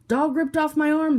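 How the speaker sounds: high, loud wounded shout. A narrator's reading. Suspect shouting at the policeman, loud high shout. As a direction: yell it out in pain. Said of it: "the man shouted".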